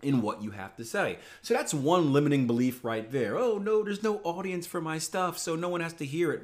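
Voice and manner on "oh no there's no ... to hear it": higher, sulky mock whine; lightly mocking a self-pitying complainer; mock pity party. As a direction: parody someone feeling sorry for himself. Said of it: "this pity party that this guy has thrown".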